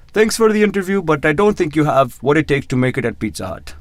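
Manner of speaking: rough imitation of an Indian accent. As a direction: quote the manager in a put-on Indian accent. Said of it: "my horrible racist Indian accent".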